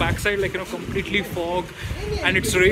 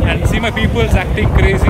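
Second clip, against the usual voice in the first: shouting over wind; 9 dB louder than usual. Real, not acted.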